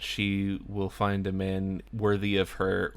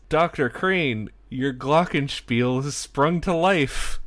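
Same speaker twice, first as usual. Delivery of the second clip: higher, louder put-on Daphne voice; 5 semitones higher, 5 dB louder than usual. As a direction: play Daphne innocently announcing it. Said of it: "and Daphne says".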